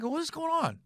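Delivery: high, hushed bewilderment; puzzled. Retold or imitated. Son retelling waiting in back room for a scuffle, hushed puzzlement. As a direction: ask it high and soft, acting baffled.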